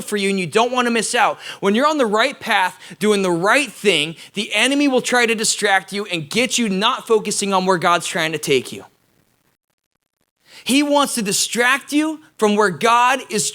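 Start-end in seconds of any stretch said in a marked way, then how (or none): none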